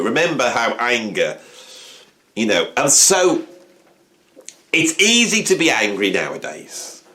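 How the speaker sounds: ordinary delivery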